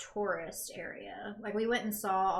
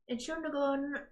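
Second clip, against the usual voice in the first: American accent; 6 semitones higher than usual. Shown as a demonstration. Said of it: "by the way you pronounce".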